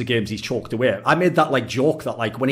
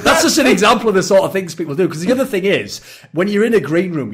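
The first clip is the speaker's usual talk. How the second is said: ordinary delivery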